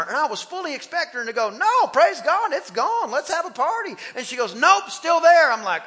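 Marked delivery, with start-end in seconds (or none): 1.5-4.0 imagined whoop of relief; jubilant exclamation. Retold or imitated. Imagined jubilant reply he expected. 4.5-5.5 higher, louder mimicked denial; punchy quoted reply; blunt letdown. Acts out her deflating reply.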